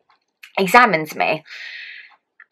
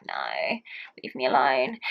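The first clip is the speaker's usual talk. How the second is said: very soft, drained, much quieter; weak groaning refusal; 6 semitones lower, 11 dB quieter than usual. Retold or imitated. Mother in labour brushing off husband's food, faint moan. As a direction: push him away with your last bit of energy.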